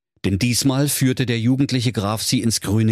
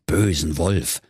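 plain reading voice

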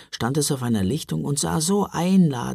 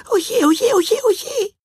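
very high squeaky wail; wailing gingerbread man; 4 dB louder, slower than usual. Acted. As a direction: moan in panic like a tiny cookie. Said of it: "jammerte der Lebkuchenmann".

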